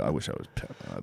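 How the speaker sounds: breathy hushed wish, almost unvoiced; a secret longing murmured to himself; mock wistful whisper. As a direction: whisper it like a starstruck fan. Mock fan quietly wishing.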